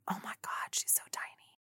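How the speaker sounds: hushed, breathless whisper of amazement; awed whisper. Retold or imitated. Starstruck fan whispering about Kim Kardashian. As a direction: whisper it like you can't believe your eyes.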